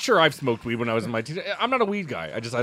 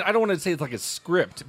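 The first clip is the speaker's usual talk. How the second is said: usual speaking voice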